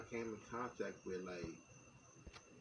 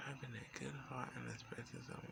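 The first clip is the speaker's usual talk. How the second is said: whispering; 4 semitones higher, 7 dB quieter than usual. Acted. Whispered reading of a post.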